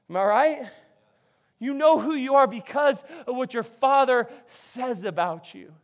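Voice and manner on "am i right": normal talking voice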